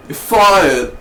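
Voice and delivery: a hint of a blustering mogul firing someone; Donald Trump. Popping out of the water to fire someone, mock bluster. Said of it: "Donald Trump coming out".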